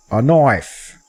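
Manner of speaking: slow, terrible mock Australian accent; Crocodile Dundee. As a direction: put on a bad Australian movie-hero accent, drawn out. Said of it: "terrible accent, uh, of Crocodile Dundee".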